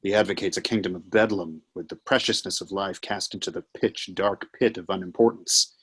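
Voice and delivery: normal talking voice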